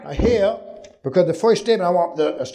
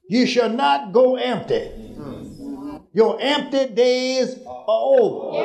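high, ringing shouted decree; prophetic declaration; 6 semitones higher, slower than usual. Acted. Preaching climax.

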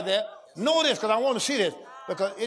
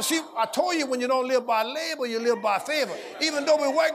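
normal talking voice

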